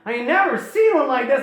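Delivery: high-pitched, lively character voice; marvelling enthusiast. Retold or imitated. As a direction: chatter on in mock wonder. Qualis keeps marvelling at the dragon.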